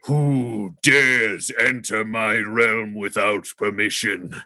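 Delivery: slow, booming machine-priest voice; menacing and drawn out, commanding; dragon voice. Acted. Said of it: "you just turn to hear the voice".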